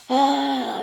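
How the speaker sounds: loud, high mock "ah" of frustration; imitated frustrated groan. Mom can't name what she feels, imitated frustrated cry. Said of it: "she gets very frustrated".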